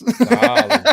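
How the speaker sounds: high, loud, laughing mock purr; breathy vintage purr attempt. Shown as a demonstration. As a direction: overshoot the diva purr for laughs. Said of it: "I can't say it like she does".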